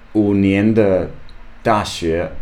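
usual speaking voice

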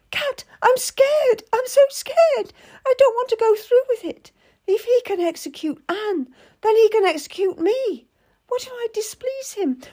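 high, trembling fear; a girl terrified of the king; scared young lady. A narrator's reading. Lady confesses her fear to Cat, high and scared.

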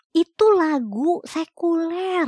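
high, slow, drawn-out correction; emphatic scolding. Retold or imitated. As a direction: lean hard on every word to set him straight. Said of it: "gue bilang gitu".

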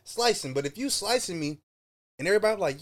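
ordinary talk

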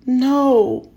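high, loud sobbing cry of no; sobbed no. A narrator's reading. Boy sobbing no as he runs from mother. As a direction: break into a sob. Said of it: "I sobbed".

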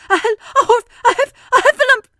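high, loud, stammering gasps; breathless panic. Acted. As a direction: struggle to get the word out. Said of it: "breathing so hard that he could hardly speak".

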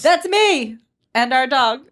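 loud, high, drawn-out interjection; yelling. Real, not acted. Cutting in on the family introduction, loud high yell. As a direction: yell it over the co-host. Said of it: "Sorry, I'm yelling".

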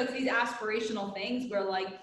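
ordinary talk

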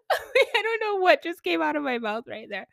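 high, laughing through the words; real embarrassed-at-yourself giggling talk. Real, not acted. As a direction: crack up at your own accidental snort. Laughing at her own snort.

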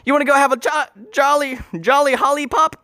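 high-pitched whimsical candy-seller voice; high, playful cartoon host; Wonka elevator. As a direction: offer sweets in a high, silly voice. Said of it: "like a Wonka e-, elevator".

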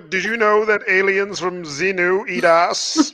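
slow, hammed-up character announcement; mock stage greeter. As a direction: announce it slowly, as a silly stage act. Plays his put-on stage alien character.

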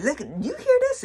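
high, eager "Look, you hear this?" to husband; thrilled, pitch jumping up; excited showing. Retold or imitated. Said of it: "I'd be like".

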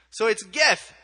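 put-on comic Kenyan-accented confidence. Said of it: "I'll do the accent".

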